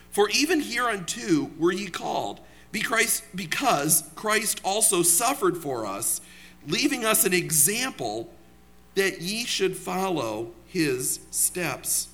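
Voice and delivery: scripture reading. Acted. Preacher reads verse to congregation, solemn scripture reading. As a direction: read the verse deliberately, phrase by phrase.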